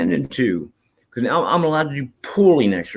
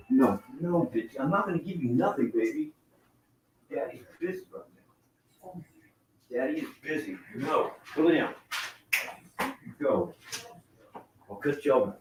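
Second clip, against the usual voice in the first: much softer, partly whispered scolding of a pet; quietly brushing off the pet begging for food; talking softly to a pet; 2 semitones higher, 10 dB quieter than usual. Real, not acted. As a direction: hush the begging pet while staying on stream. Shooing his pet away from the food.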